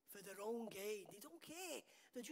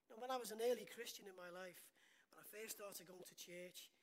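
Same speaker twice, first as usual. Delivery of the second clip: normal talking voice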